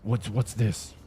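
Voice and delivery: loud accusing outburst, hammed up; a show of how it did not go; mock angry confrontation. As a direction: pretend to tear into her. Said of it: "I didn't confront her like".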